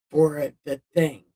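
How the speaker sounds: ordinary delivery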